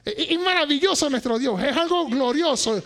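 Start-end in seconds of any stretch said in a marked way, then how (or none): none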